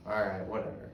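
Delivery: softly dropped, indifferent reply; apathetic. Retold or imitated. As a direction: give in quietly without caring. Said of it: "same apathetic".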